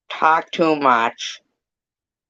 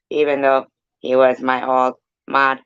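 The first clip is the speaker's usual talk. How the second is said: ordinary talk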